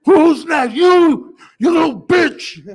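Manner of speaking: high, loud raging yell; furious shout. Retold or imitated. Boy after the fight yelling at the other kids. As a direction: scream a challenge at the whole playground. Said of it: "I started screaming".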